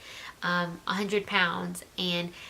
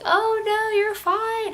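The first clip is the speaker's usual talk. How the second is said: much higher, louder, breezy nurse voice; mock chirpy nurse; 8 dB louder, much slower than usual. Nurse waving off the scared patient's worry, high-pitched mock cheer. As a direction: play the nurse brushing off her fear, pitched way up.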